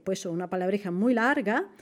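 ordinary talk, nothing marked

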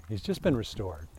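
normal talking voice

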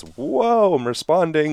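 high, jolted whoa that settles into words; startled whoa. Acted. The nervous system reacting to a sudden crash, high startled whoa. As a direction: voice the body's alarm, caught off guard. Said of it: "It says".

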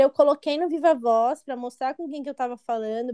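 ordinary delivery

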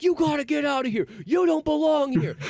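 high, loud imitation of outraged ladies; freaking out. Ladies driving a Raiders fan out, freaking out. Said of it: "they're freaking out like".

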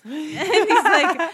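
bursts into high laughter after the punchline; laughing. Retold or imitated. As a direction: say it then crack up laughing. Witch joke, laughing.

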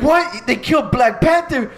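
incredulous imitation of audience shock; shocked disbelief. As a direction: cry out like a stunned moviegoer.

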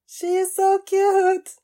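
high, loud gush over cuteness. Real, not acted. Viewer gushing over the cute girl on screen, gushing. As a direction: squeal it with delight.

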